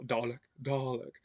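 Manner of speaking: Dalek in a Mid-Atlantic accent, Dalek crisp. Shown as a demonstration. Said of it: "I've been learning the, uh, Mid-Atlantic accent".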